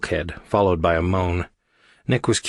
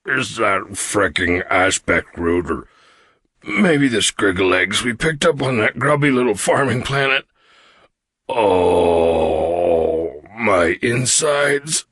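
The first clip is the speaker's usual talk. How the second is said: big alien groaning in pain; slow, drawn-out bellyache moan; put-on suffering hulk voice; 4 dB louder, slower than usual. A narrator's reading. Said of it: "Karg groaned".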